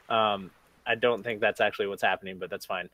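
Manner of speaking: ordinary talk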